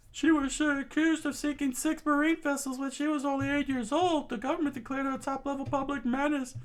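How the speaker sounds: high, quick exposition voice; high fast telling. Acted. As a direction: rattle off the backstory, high. Soldier explains.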